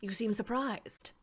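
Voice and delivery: ordinary talk, nothing marked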